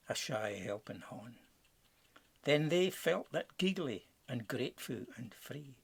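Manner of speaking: usual speaking voice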